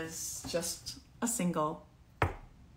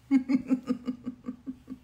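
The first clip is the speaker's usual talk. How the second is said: high spooky ghost cackle; evil cackle; 6 semitones higher than usual. Pretend. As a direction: cackle like a haunting spirit. Spooky sign-off after scary voice.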